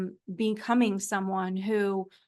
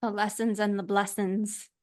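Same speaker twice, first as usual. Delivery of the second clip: lessons and blessings in a Geordie lilt; playful Geordie accent. Pretend. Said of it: "In a Geordie accent".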